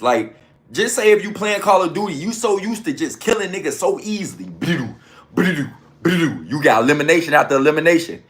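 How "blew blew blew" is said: low, quick blew-blew gun noises; mock gunshot sounds. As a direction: imitate a game gun firing. Imitating gunfire in a game.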